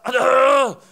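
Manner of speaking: loud high "Aduh" shout; a startled groan of dismay; dismayed cry. Retold or imitated. Churchgoer reacting to a blunt pastor, loud dismay. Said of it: "kadang jemaat itu merasa".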